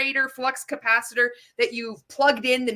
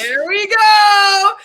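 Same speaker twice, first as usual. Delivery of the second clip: much higher, louder, slow, hammed-up reveal; mock announcer; 8 semitones higher, 12 dB louder, much slower than usual. Mocking the presenter's device, a hammed-up showman reveal. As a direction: unveil it like a cheesy showman.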